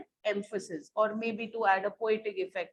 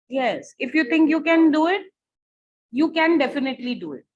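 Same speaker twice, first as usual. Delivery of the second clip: louder, higher, pressing on can; emphatic; 4 semitones higher, 5 dB louder, faster than usual. Shown as a demonstration. Teacher shows students the emphasis by raising her voice. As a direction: push can and definitely hard. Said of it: "how am I speaking? I'm emphasizing".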